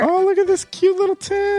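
high, delighted cooing voice; cutesy high coo. Real, not acted. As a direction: coo over it like a cute trinket. Cooing over a gift tin.

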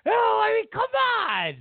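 high, incredulous burst of disbelief; exasperated outburst. Real, not acted. Exasperated reaction in comic rant.

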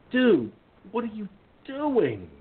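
high-pitched, incredulous mock complaint; exasperated protest. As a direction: stretch out the question in high, baffled protest. Mock protest at friend's generosity.

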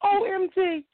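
high, stretched-out, overwhelmed disbelief; real starstruck joy, pitch way up; ecstatic caller. Real, not acted. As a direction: gasp it out high, overjoyed to get through. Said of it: "so ecstatic right now".

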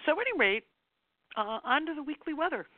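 very high, teary voice; choked up, trying to move on; crying. Real, not acted. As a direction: push on with the show through tears. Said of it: "Sorry for crying".

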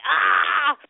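mock exasperated "ah"; frustrated cry. Wife reacting to husband about defrost, mock frustrated cry. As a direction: let out a comic groan of defeat. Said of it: "I'm like".

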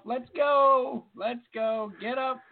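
calling up the stairs; mock mom wake-up call; mom waking kids. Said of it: "Like my mom used to be to wake us up".

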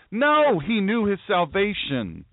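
ordinary delivery

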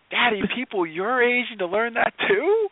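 a dad playing his kindergartner asking him a question, high childlike voice; imitating his daughter. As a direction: put on a small child's curious voice. Dad voicing his young daughter.

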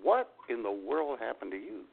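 normal talking voice

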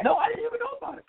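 husband caught off guard, voiced high and fast; surprised husband. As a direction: play the clueless husband, squeaky and quick. Voicing the other husband.

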